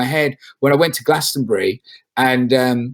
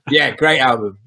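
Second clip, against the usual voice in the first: ordinary talk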